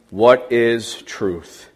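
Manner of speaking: a slow, doubting what is truth; slow musing question. Acted. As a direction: let Pilate wonder aloud, slowly. Pilate's question in the reading.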